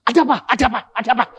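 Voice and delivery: high, loud, rapid-fire alarm; startled half to death. Retold or imitated. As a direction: jolt awake in panic, imitating the wife. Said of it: "kaget setengah mati". (istri kaget, panik dan lantang)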